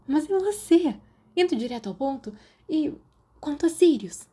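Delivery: put-on high, lively girl's voice; a curious schoolgirl pressing for gossip; Marlene's voice. A narrator's reading. (voz aguda de garota curiosa)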